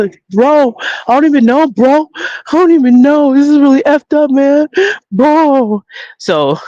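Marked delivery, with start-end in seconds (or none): 0.3-5.8 high-pitched imitation of a distraught "bro" girl; upset young woman. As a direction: mimic her upset, whiny way of talking. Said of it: "so this is how she talks".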